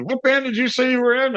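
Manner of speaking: high retold yell at normal volume; mock screaming. Owner screaming question at musician, mock yell. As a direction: demand it high, imitating an irate man. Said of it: "while he's screaming at me".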